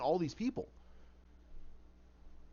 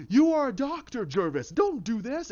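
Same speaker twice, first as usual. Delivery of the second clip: loud, high panicked plea; desperate plea; 7 semitones higher, 6 dB louder than usual. Acted. Crane begging Jervis to stop, desperate. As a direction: cry out, pitched way up.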